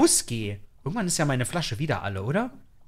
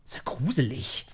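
a hushed whispered aside; 3 semitones lower, 8 dB quieter than usual. Real, not acted. Player in a creepy room, whispering to viewers. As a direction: breathe it like you fear being heard. Creepy moment in horror game.

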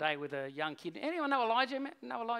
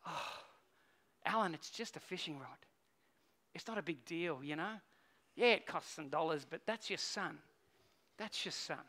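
softer, lower talk to himself; heartbroken softening; 3 semitones lower, 5 dB quieter than usual. Retold or imitated. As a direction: gently talk yourself down. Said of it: "my heart broke for him".

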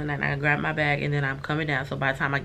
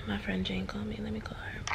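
soft unvoiced whisper, higher; 3 semitones higher, 9 dB quieter than usual. Real, not acted. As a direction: whisper it so the caller won't hear. Whispers aside mid-call.

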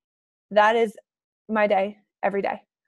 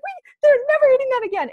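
much higher, loud and firm; indignant vow; 14 semitones higher, 4 dB louder than usual. Retold or imitated. Mom vows to ban junk food, high and loud. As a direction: swear it off for good. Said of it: "I came home on a rant".

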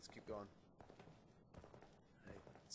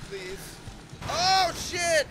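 high frightened cry; scared scream; 11 semitones higher, 9 dB louder, much slower than usual. Real, not acted. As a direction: shriek in fright. Monster appears, scream.

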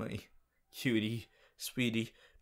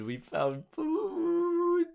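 high, slow, drawn-out joy; elated; much slower than usual. Real, not acted. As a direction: stretch it out in triumph.

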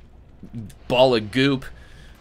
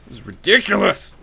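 high loud exasperated shout; frustrated outburst; 8 semitones higher, 4 dB louder than usual. Real, not acted. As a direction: burst out in exasperation. Repeated as outburst.

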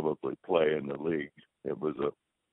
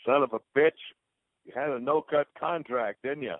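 retold holler; 8 semitones higher than usual. Teammate hollering across the street at him, a mock yell. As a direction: call it out across the road, half-playful. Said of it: "he hollers across the street".

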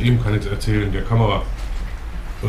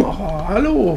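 squeaky put-on voice; 9 semitones higher, much slower than usual. Greeting in odd voice.

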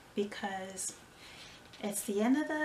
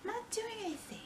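much higher, softer, out of breath; winded squeak; 12 semitones higher, 7 dB quieter, much faster than usual. Real, not acted. Winded in the kitchen, high breathless squeak. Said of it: "out of breath".

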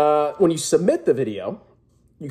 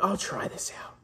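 whispering; 7 semitones higher, 10 dB quieter than usual. Retold or imitated. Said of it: "and you're like".

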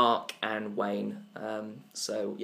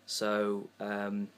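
softer earnest charity ad read; sincere donation plea, hushed; charity appeal; 4 dB quieter than usual. Acted.

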